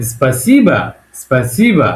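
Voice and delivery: "স্পাসিবা" with a lifted, stressed "si" and falling "ba"; model pronunciation. Shown as a demonstration. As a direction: hit "si" in "স্পাসিবা", let the end fall. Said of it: "আমরা পড়বো কিভাবে?".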